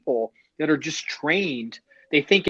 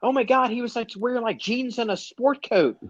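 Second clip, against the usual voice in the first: mocking shocked passengers; 10 semitones higher than usual. Podcaster mocking passengers shocked by plain clothes, high mock gasp. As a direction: sneer at their shock in a high voice.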